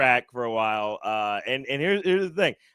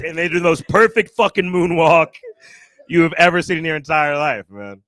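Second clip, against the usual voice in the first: ordinary delivery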